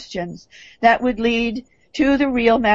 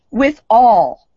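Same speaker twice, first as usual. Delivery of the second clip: "all" hit louder and held; stress on all; 6 dB louder than usual. Real, not acted. Speaker quotes the co-sponsors' letter, stress on "all". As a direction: punch "all" loudly, then pause. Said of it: "underlined all".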